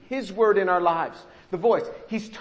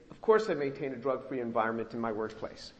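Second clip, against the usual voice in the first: dutiful form answer; 8 semitones lower, 7 dB quieter than usual. Retold or imitated. Mimics routine answer on silly form.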